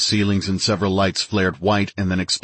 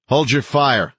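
high, raised commanding yell; 7 semitones higher, 4 dB louder, slower than usual. A narrator's reading. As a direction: yell the order to halt everyone. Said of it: "I yelled".

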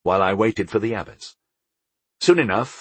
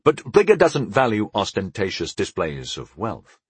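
usual speaking voice

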